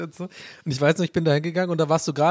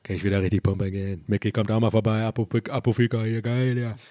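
deep, low rapper impression; low, lazy Rostock rapper voice; [name]; 4 semitones lower than usual. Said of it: "dein [name]".

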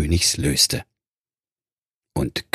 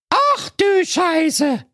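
very high, slow drawn-out exclamation; shocked cry; much slower than usual. Acted. Jacqueline caught out and laughed at, slow high shocked cry. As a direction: stretch out the shock in a squeal. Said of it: "rief Jacqueline".

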